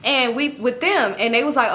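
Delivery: ordinary talk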